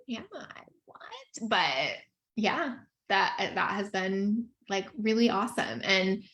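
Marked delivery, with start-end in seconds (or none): 0.1-1.5 high, hushed mock disbelief; baffled whisper. Mom marveling at her own obsession, baffled whisper. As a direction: whisper it to yourself, playfully baffled. Acting out baffled self-questioning.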